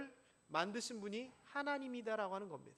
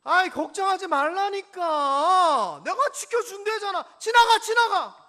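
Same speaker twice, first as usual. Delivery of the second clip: higher, louder, impatient urging; exasperated insisting; 9 semitones higher, 14 dB louder, slower than usual. Retold or imitated. Husband impatiently urges his wife past the dog. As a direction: push her on, losing patience.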